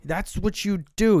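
loud, high-pitched, drawn-out blame; acted angry accusation. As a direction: hurl the blame slowly, imitating a film quarrel. Acting out the film's quarrel.

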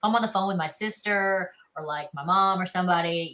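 usual speaking voice

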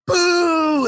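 long, high-pitched mock boo; crowd boo. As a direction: jeer like a hostile audience. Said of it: "starts booing me again".

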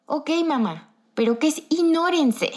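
high, innocent little-kid voice, imitated; little brother. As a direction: play a puzzled small child questioning mom. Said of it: "mi pobre hermano ahí todo chiquillo". (vocecita aguda de hermanito confundido)

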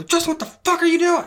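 loud, high-pitched reenacted yell; retold outraged yell. Man yelling at friend peeing on him, retold outraged yell. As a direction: shout it like the shock just hit. Retelling his angry outburst at friend.

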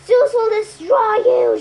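a Fury threatening Percy, loud and menacing; menacing promise. A narrator's reading. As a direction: promise doom in a monster voice. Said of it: "she promised".

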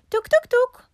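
high, loud knock-knock sound; knocking noise. A narrator's reading. Rabbit knocks on lion's door, high vocal knocks. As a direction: imitate sharp door knocks with the voice.

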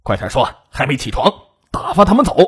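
hushed menace rising into an insistent order; coercing. A narrator's reading. Robber forcing a captive to send visitors away, threatening. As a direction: pressure a captive under your breath, then push harder. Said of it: "唐武威逼他说".

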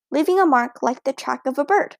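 plain reading voice

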